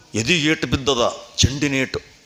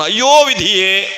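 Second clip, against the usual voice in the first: a louder wail of grief; loud lament; 4 semitones higher, 6 dB louder than usual. Acted. King collapsing on the throne, wailing in grief. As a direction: cry out against fate. ಕುಸಿದು ಕೆಳಗೆ ಬೀಳ್ತಾನ.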